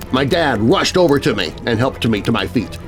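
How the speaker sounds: plain reading voice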